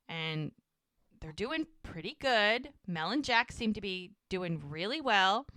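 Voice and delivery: ordinary delivery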